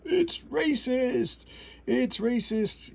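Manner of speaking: high, drawn-out mocking whine; mock outcry. Critic mimicking people who object to the comic, high mock outcry. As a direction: stretch it out high like an exaggerated complainer.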